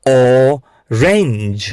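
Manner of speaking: "Orange" broken after a long ઓ; slow sounding-out. Shown as a demonstration. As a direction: draw out "orange" in two parts for learners. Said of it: "બોલો, આને કહેશો". ("Orange" ખૂબ ધીમે, ઓ છૂટો પાડીને)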